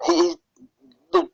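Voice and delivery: stumbling stutter, losing the words. Real, not acted. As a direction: trip over the start of the sentence. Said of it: "I'm stuttering".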